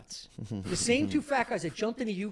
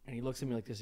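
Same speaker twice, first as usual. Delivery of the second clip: ordinary talk, nothing marked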